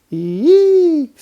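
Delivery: put-on piercing high girl shriek. A narrator's reading. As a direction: shriek at the mouse. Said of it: "squealed Karen".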